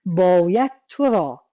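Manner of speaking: باید تو را slow, تو pressed; باید تو را with تو held; stress on تو. Shown as a demonstration. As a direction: lean on تو in باید تو را.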